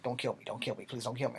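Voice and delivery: a half-hearted plea for mercy; mock begging. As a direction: teller voicing the bully begging, understated. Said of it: "he's begging for his life now".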